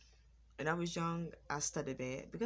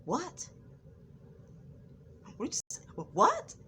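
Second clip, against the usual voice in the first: nasty; 10 semitones higher than usual. Retold or imitated. Sister to a stuttering sibling talking fast, nasty imitation. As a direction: imitate a rude sister, pitch shooting up. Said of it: "my sister was so nasty".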